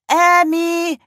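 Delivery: high, loud donkey honk. A narrator's reading. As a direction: bray her name out like a donkey. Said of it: "trötete er".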